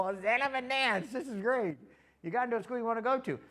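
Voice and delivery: high, loud burst of delight; thrilled grandpa outburst, retold; excited congratulations. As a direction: cheer the good news at full voice. Grandpa retells his thrilled reaction.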